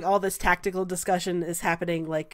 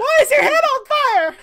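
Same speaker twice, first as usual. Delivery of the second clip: high, loud panicked yell; comic panicked outcry; alarmed shout; 9 dB louder than usual. Acted. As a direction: shriek the question in hammed-up alarm. Alarmed cry at burning head.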